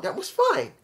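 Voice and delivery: ordinary talk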